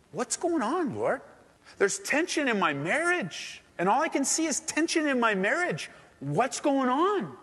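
high, pleading cry to God; pleading prayer. Pretend. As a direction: beg the Lord for answers, voice lifted high.

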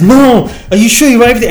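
high, loud, rushed outburst; imitated anger. Colleague doubting the written-down process, imitated angry outburst. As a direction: act out his exasperated, angry challenge. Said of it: "he got really angry".

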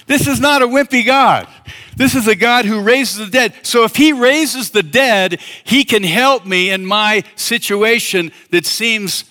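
full-force preacher's fervor; fervent preaching. Acted. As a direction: preach it with rising conviction.

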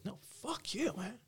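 high, soft squeaky mock rant; mock squeaky outburst. Student mimics his own teenage outburst at classmates, squeaky and high. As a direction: squeak it out like a cartoon brat.

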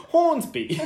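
high squeal of a laugh. Real, not acted. Host laughing to cohost, blurting their home suburb.

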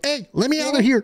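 lightly voiced little mouse; high squeaky mouse pleading. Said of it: "I give the mouse a voice".

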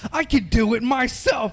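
high, slow mock shout; mock proud character. Retelling wheelchair guy, slow high mock shout. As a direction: announce it slowly and loud. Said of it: "He was like".

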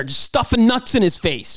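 usual speaking voice

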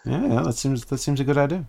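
softly muttered agreement; murmuring kobolds. Acted. Kobolds confer among themselves about the party, murmuring. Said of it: "start murmuring to themselves a little bit".

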